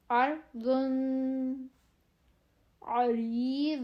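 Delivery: heavy ض drill; heavy daad drill. Shown as a demonstration. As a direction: press each ض firmly. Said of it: "ضاد".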